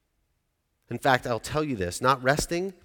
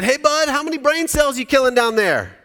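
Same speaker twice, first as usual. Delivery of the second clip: high, loud call down to the basement; yelling downstairs; 11 semitones higher, 10 dB louder than usual. Retold or imitated. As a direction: shout down to the kid in the basement. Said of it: "I yelled down to the basement".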